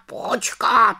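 high, falling repeated wait; interrupting raven. A narrator's reading. Raven Abraxas interrupts a second time, high, falling. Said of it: "skočil jí do řeči Havran".

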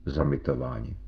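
low, faint remnant of a voice; stín hlasu. A narrator's reading. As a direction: barely get the voice out, low and soft. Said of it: "vlastně jen stín hlasu". (slabým, hlubokým hlasem)

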